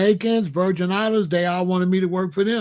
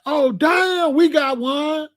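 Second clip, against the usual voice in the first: higher, mock-thrilled "oh damn"; a pitched-up, delighted retelling of the find; excited discovery; 9 semitones higher, much slower than usual.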